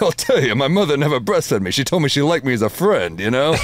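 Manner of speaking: pushed-up Rodney delivery; Rodney Dangerfield impression. Telling a joke to Rodney's wife as Rodney, high and punchy. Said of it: "I'm literally doing a Rodney impression".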